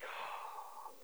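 breathy whispered "Oh"; whispered realization the kid is right; sheepish whisper. Retold or imitated. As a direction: let it out like you got caught. Caught out by daughter.

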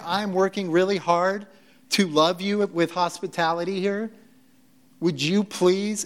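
hammed-up impatience, leaning on each phrase; snarky Martha. Martha pleading with Jesus to read the room, snarky. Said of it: "in a snarky way".

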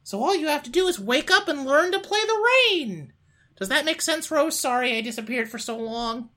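high squeaky cartoon cat, lightly done; a dreamy cartoon cat rambling; Mickey Mouse impression. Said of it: "a bad Mickey Mouse impression".

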